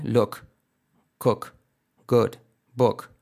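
"Look, cook, good, book" with short vowel near O. Shown as a demonstration. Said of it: "casi como una O".

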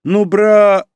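high, loud drawn-out whine; wheedling. A narrator's reading. Little sister pestering her brother again, wheedling. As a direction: beg loudly until cut off. Sister resumes begging, cut off.

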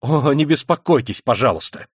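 crying out. A narrator's reading. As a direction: call it out with bravado. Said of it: "вскричал Раскольников и вдруг захохотал".

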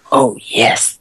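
breathy stage whisper. Pretend. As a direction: whisper it conspiratorially. Whispered repeat of "oh yes.

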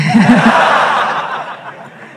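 real laugh in quick bursts; amused laughter. Real, not acted. Speaker confessing she never said that, laughing. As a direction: break up at your own admission. Laughs after admitting the joke.

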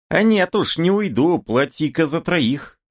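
high-pitched woman refusing to budge; high, indignant peasant woman; defiant woman. A narrator's reading. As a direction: argue back in a high voice. Woman haggling angrily with attackers.

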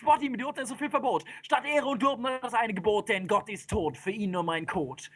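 high, driving storytelling; wild, high-pitched epic recital; frenzied narration. A narrator's reading. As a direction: race on breathlessly like a stampede.

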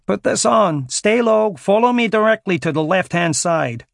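shouted over rotor noise; shouting. Acted. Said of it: "shouted the American next to him".

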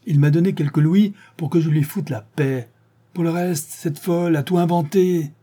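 high, pleading voice of an accused man defending himself; protesting accused. Acted. Séraphin keeps protesting. (accusé qui proteste, voix aiguë et pressante)

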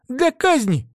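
high, alarmed exclamation; agitated novice. A narrator's reading. Novice explains why elves demand the saint, alarmed. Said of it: "взволнованный голос".

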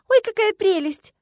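high, loud, drawn-out cooing delight; delighted exclamation. A narrator's reading. Classmate spotting a cute pet, delighted squeal. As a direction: gush over an adorable creature. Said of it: "воскликнула одногруппница".

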